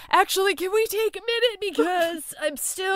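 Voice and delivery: high-pitched imitation of being overcome; mock overwhelmed. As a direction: play at being too emotional to go on. Imitates friend overcome about Batman.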